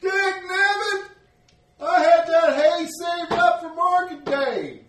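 very high, slow, loud wail; distraught farmer. Acted. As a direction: whine about the lost hay. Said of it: "one guy's like".

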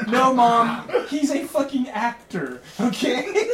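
half-laughing exasperation, pushing back hard; exasperated retort. Retold or imitated. Son snapping back at his mom, loud and laughing.

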